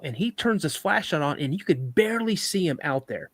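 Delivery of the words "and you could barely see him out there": plain reading voice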